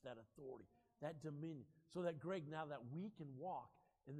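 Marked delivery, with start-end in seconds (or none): none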